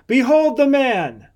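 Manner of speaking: loud, high declaration to a crowd; public proclamation. A narrator's reading. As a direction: present him with a ringing call. Pilate presents prisoner to the crowd.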